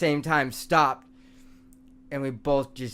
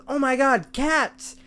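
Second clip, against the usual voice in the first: high, excited shout; imitating a stoned friend spotting cats; 10 semitones higher, slower than usual. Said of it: "He goes".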